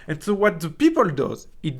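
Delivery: ordinary talk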